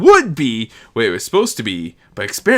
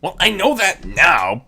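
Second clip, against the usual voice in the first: rueful grumble; 4 semitones higher, 5 dB louder than usual. A narrator's reading. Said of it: "Perrite grumbled ruefully".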